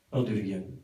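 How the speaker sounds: low, soft warning; a quiet fatherly warning; stern dad. Retold or imitated. Father warning his son after the fight, low and quiet.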